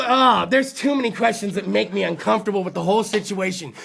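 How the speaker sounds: ordinary talk, nothing marked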